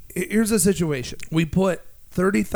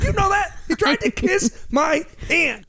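excited; 12 semitones higher than usual. Real, not acted. Retelling Godfather joke.

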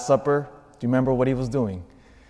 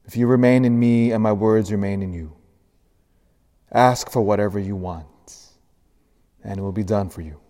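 gospel reading; 2 semitones lower than usual. Acted.

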